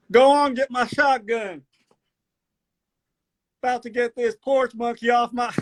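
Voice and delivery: excitable country character with high yelps; Southern country accent. Acted. Country boy spots a city slicker, excitable Southern accent. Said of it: "I actually enjoy this accent".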